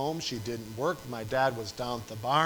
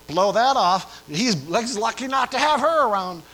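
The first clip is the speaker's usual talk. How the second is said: loud, high brush-off, as if waving it away; mock dismissive shout; 9 semitones higher, 12 dB louder than usual. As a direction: shout it off like it's nothing. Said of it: "You say".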